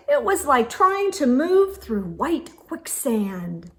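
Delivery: ordinary delivery, nothing marked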